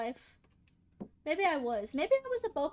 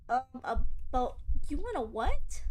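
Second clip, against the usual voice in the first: usual speaking voice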